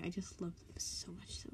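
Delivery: gushing little whisper. Real, not acted. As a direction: whisper it fondly. Gushing aside about favourites.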